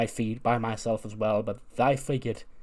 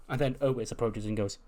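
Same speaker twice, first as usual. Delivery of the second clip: ordinary delivery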